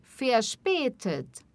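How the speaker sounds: Verspätet with the ä said as an open E, slowly; umlaut ä as E. Shown as a demonstration. Teacher to students, modelling the ä in Verspätet. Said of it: "O A tremado, som de E".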